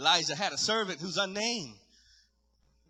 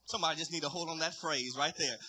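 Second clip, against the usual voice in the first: ordinary talk, nothing marked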